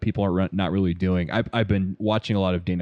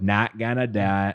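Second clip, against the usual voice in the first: George H W Bush impression; slower than usual. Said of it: "his George H W Bush".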